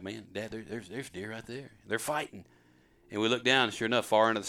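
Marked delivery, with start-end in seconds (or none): 0.0-2.5 much softer, under the breath; retold hushed alert. Son alerting Dad to deer from the blind, hushed.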